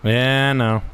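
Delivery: ordinary talk, nothing marked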